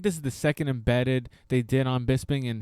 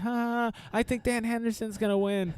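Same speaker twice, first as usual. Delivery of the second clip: put-on a light British kid's accent, pitched higher; child's British accent; 8 semitones higher than usual. Bisping's son answering his dad, light British accent. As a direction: sing it out like a cheeky British boy. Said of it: "In his British accent".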